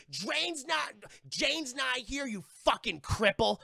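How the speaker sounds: high, loud hostile outburst; acted angry shriek at the one calming her; shrill shrieking. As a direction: spit the insult in a high voice. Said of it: "just the shrill fucking shrieks".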